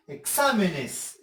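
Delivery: "Exámenes" slowly, leaning on "xá"; e-XÁ-menes, drawn out and falling; stress on XÁ. Shown as a demonstration. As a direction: hit the second syllable of "Exámenes". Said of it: "identificar la sílaba tónica".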